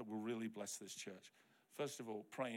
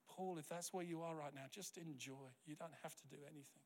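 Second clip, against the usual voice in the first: soft, soothing pastor's counsel; gentle reassurance; 7 dB quieter than usual. Retold or imitated. Pastor reassuring a hurting man.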